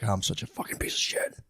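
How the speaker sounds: breathy whisper of self-disgust; whispered self-reproach. Retold or imitated. Husband catching his own bias, whispered self-reproach. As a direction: whisper it to yourself, ashamed.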